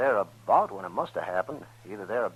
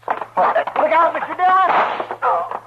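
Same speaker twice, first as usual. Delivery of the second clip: desperate high yell of warning; shouted warning; 12 dB louder, much slower than usual. Acted. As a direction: scream the warning across the street.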